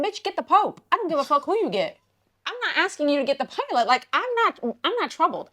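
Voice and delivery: high-pitched sassy protest; indignant comeback. Retold or imitated. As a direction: argue back high and exasperated. Acted quarrel with flight attendant.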